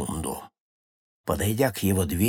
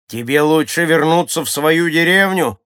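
loud, raised-pitch warning; threatening advice; 5 semitones higher, 7 dB louder than usual. A narrator's reading. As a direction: advise him loudly, with menace. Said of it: "угрожающе посоветовал ему". (громкий угрожающий совет)

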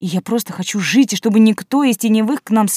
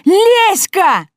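loud yell, much higher; 12 semitones higher, 6 dB louder than usual. Acted. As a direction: scream the name out. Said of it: "затем завопила".

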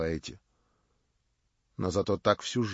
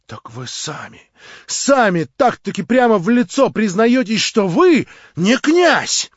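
much higher, louder, proud; Marya, defiant; 11 semitones higher, 12 dB louder than usual. A narrator's reading. Marya scorns her would-be prince, high and loud. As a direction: cry it out with haughty scorn.

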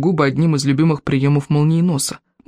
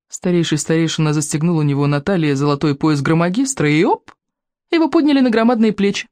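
ordinary delivery, nothing marked

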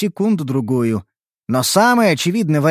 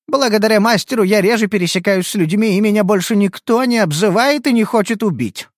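put-on gentle illithid girl; 6 semitones higher than usual.

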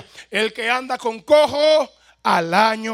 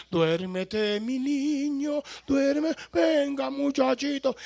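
put-on soft, slow, cooing like a mother rocking her baby; mother's lullaby; 3 semitones higher, 5 dB quieter, slower than usual. Mother soothing her newborn to sleep, soft slow lullaby. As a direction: lull the baby to sleep, softly and slowly. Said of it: "su mamá que le decía".

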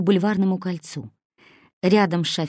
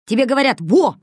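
insistent; 7 semitones higher, 7 dB louder than usual. A narrator's reading. Kosoy insists, spreading fingers.

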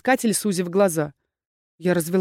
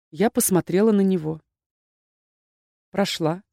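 plain reading voice

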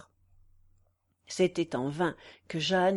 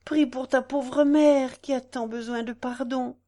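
anguished cry; 4 semitones higher, 5 dB louder than usual. A narrator's reading. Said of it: "s'écria madame de La Tour Ménil".

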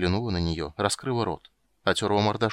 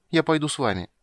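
put-on high little-girl voice, no shouting; child giving in; 7 semitones higher than usual. A narrator's reading. Girl agrees to go with witcher, childlike and subdued.

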